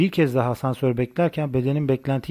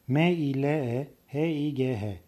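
softer, letter-by-letter spelling; 5 dB quieter, slower than usual. Shown as a demonstration. Narrator gives spellings of foreign words, slow letter-by-letter. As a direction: spell it slowly, each letter set apart. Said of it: "yabancı kelimelerin yazılışları".

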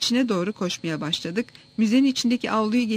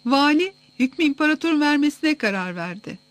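higher-pitched announcement to a gathering; raised voice; 6 semitones higher, 4 dB louder than usual. A narrator's reading. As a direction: address the whole crowd. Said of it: "sesini yükseltti".

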